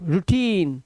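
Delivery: put-on रूटीन as "roo-teen"; "oo" sound. Shown as a demonstration. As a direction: model रूटीन with उ in the first syllable. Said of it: "ओयू का उच्चारण है उ".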